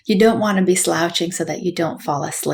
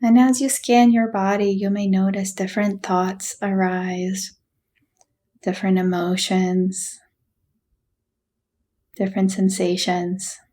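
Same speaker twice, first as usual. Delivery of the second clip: guided meditation. Acted. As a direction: gently guide them through a body scan, unhurried. Leads a body scan meditation.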